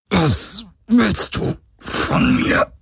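high, slow, strained through gritted teeth; pressed through clenched teeth. Acted. Galen fights the mind control, forcing words through his teeth. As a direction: squeeze each word out against the pain. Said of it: "presste er zwischen zusammengebissenen Zähnen hervor".

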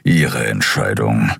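low, slow rumble; grumbling. A narrator's reading. Keru accepting Kai's choice to stay, grumbling. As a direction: grumble it dismissively. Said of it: "brummte Keru".

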